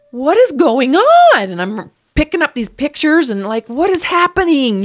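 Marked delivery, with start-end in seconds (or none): 0.1-1.5 slow, high, loud cry of disbelief; distraught outcry. Retold or imitated. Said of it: "and I just lost it". 3.7-4.8 high, loud cry of disbelief; distraught outcry. Retold or imitated. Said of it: "and I just lost it".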